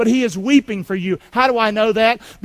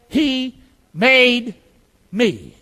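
"He... made... me" slowly, each word set apart; say-along; 2 semitones higher, 4 dB louder, much slower than usual. Shown as a demonstration. Preacher leads congregation in repeating, spaced-out words. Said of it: "Can you say that?".

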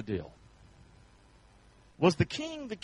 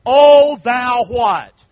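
stretched, loud slow call; king's rebuke; 4 semitones higher, 13 dB louder, much slower than usual. Acted. The king rebuking his servant, loud and slow. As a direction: drag it out as an outraged king.

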